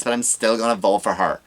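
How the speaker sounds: usual speaking voice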